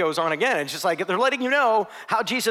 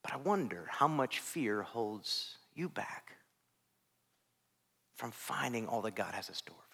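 whisper; 7 semitones lower, 13 dB quieter than usual. Real, not acted. Preacher drops to whisper.

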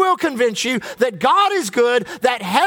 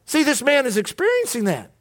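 ordinary delivery

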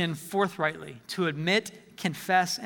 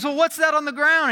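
incredulous question; 8 semitones higher, 6 dB louder than usual. Retold or imitated. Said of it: "And he goes".

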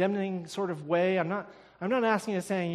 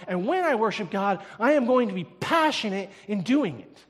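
loud, high, fervent declaration; the preacher voicing David, loud and fervent; passionate vow as David; 5 semitones higher, 9 dB louder than usual. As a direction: proclaim David's vow with full force. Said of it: "David said".

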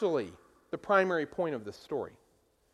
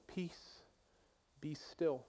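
"Peace, be still" breathed softly, barely voiced; soft gentle command; 4 semitones lower, 16 dB quieter, much slower than usual. Preacher mimicking the gentle Jesus of popular imagination, soft hush. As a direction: hush the storm gently, almost a whisper. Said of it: "Is that how you hear it?".

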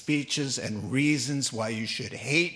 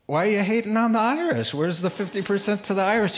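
playful teasing; 6 semitones higher, 5 dB louder, faster than usual. Retold or imitated. Teasing the tattooed man.

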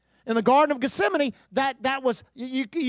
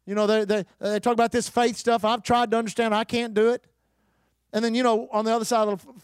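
ordinary talk, nothing marked

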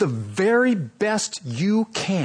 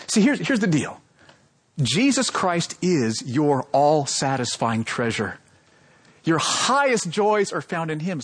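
normal talking voice

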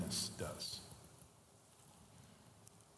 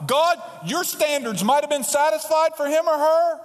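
resentful prayer; 7 dB louder than usual. Retold or imitated. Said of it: "here's what we're saying to God".

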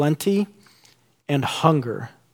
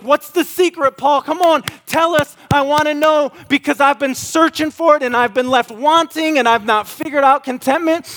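very high, louder, begging for the answer; eager pleading; 13 semitones higher, 5 dB louder than usual. Retold or imitated. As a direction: plead with Paul to spill the secret. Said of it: "And you're like".

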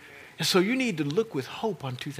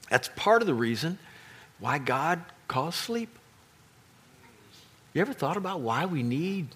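usual speaking voice

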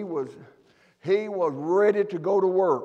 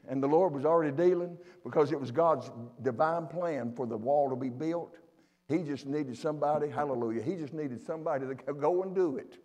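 ordinary delivery

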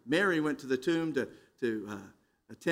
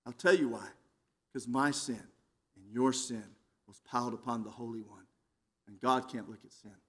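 low, soft, grave answer; hushed, solemn; 9 semitones lower, 9 dB quieter than usual. Real, not acted. Preacher explaining the cross to his congregation, hushed and solemn. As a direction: drop right down in pitch and volume, solemnly.

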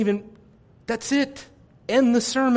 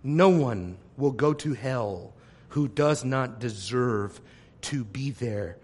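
much lower, much softer, grave; solemn hush; 9 semitones lower, 9 dB quieter than usual. Acted. Preacher to congregation, solemn on eternal hell, grave hush. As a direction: drop to a grave near-whisper.

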